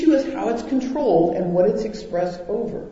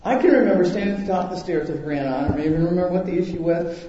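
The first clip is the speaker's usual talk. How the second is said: normal talking voice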